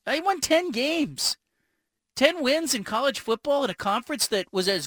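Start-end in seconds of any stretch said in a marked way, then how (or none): 0.1-1.4 slow, high and pointed; emphatic. Real, not acted. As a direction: drive the point home slowly. Rebutting critics of grade.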